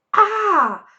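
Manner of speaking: sharp high loud gasping cry. A narrator's reading. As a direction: cry out as the liquor burns. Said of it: "gasped the smallest fox".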